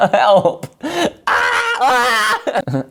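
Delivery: laughing through a plea for help. Real, not acted. Giggling at own mishap, laughing help. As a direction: breaks into real laughter on help.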